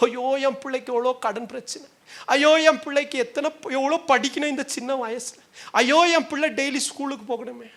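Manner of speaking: high, whining act of fretting; mock parental lament. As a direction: bemoan it in a put-on high voice. Acting parents fretting over trifles.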